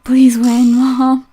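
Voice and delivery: usual speaking voice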